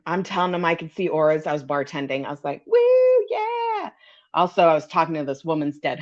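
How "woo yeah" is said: a squealed high woo of pride; high mock cheer. Bartender showing off to customers, high giddy whoop.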